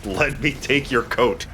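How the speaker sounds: slow, careful repeat of "Let me take your coat.". Acted. Player repeats the line slowly for his confused friend. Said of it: "I'm sorry, what did you say?".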